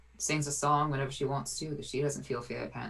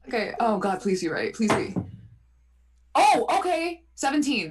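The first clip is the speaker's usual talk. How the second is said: higher and louder, pleading dice; nervous excitement; 8 semitones higher, 9 dB louder than usual. Real, not acted. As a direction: beg the dice, giddy and tense.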